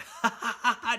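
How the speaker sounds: high hysterical cackle; hysterical laugh. Retold or imitated. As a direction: laugh to yourself, unhinged. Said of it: "laughing hysterically to himself".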